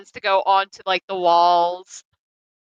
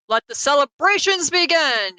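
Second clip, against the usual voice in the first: higher-pitched, mildly hammed-up announcement; festive proclamation; 6 semitones higher than usual. Game master's character addresses the town, proclaiming festivities. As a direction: lightly proclaim it to the townspeople. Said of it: "look at the town and be like".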